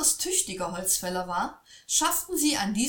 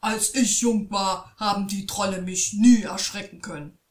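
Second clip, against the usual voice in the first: louder, cross boasting; father angry again; angry father boasts trolls never scared him; 7 dB louder than usual. A narrator's reading. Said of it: "wurde der Vater erneut böse".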